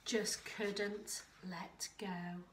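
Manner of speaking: ordinary delivery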